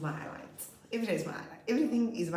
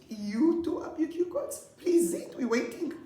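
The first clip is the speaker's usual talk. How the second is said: high, louder teasing prod, slow; impatient prodding; 10 semitones higher, 4 dB louder, slower than usual. Retold or imitated. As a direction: push him to present. Teacher prodding stuck pupil.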